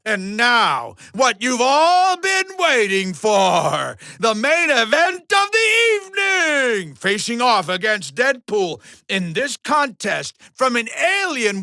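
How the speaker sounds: big ring announcer crescendo; main event intro. Acted. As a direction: build to a high, stretched-out name.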